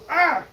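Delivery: short, high, loud yelp; startled shriek; frightened scream. Acted. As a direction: scream as if something flew at you. Bee comes out at him.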